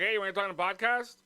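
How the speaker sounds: high, quick cheery street pitch; mock street invite. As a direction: hail a stranger brightly and fast. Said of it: "be like".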